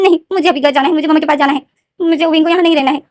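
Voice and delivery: put-on high, insistent whiny kid; child pleading. Little brother refusing his big brother, high whiny insistence. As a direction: refuse like a stubborn little boy.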